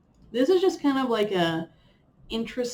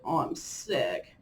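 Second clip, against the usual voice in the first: drawn-out lower mock moan; mock whiny complaining; 6 semitones lower, 3 dB quieter, slower than usual. After one sniffle, hammed-up sick moan. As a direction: moan it slowly, playing the martyr. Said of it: "I'll be like".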